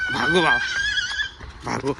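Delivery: squeaky, very high mock alarm; hammed-up cry to flee; comic high-pitched run yell; playful run call.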